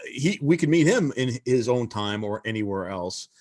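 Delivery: ordinary talk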